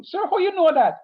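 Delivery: high, loud curious student. Retold or imitated. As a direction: ask it like a puzzled pupil. Student voice asking teacher.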